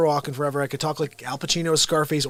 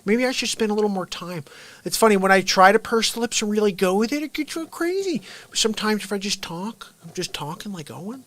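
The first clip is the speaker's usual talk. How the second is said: Owen Wilson voice; 6 semitones higher than usual. Acted. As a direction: slip into a light, higher imitation of the actor. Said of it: "my Owen Wilson's okay".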